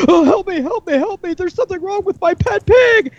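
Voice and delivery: very high, frantic put-on woman's voice; distraught pig owner. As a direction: plead in hammed-up falsetto panic. Joke's distraught pig owner pleads.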